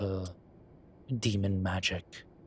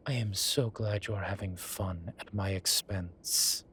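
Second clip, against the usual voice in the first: normal talking voice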